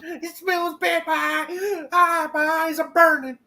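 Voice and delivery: high, slow disgusted wail; disgusted whine. Acted. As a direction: whine with burning eyes. Acting out the smell.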